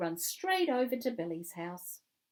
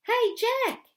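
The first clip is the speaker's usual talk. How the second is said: high, loud friendly hail; excited child calling out; yelling; 10 dB louder than usual. A narrator's reading. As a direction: yell across the playground to a friend. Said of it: "he yells".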